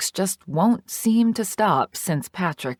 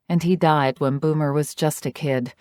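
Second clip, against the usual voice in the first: ordinary delivery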